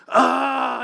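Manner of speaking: high loud imitated scream of pain; mock childbirth scream, high and loud; mock labor scream. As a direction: yell out like a woman in labor. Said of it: "And she's, like, screaming".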